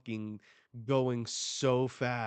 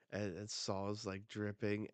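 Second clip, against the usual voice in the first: ordinary talk, nothing marked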